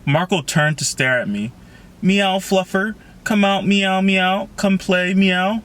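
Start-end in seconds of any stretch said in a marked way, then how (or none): 2.0-5.6 high-pitched meowing coax; mock cat call. A narrator's reading. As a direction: call out like a pretend kitty, voice pitched up. Marco mimics cat calling Fluffer.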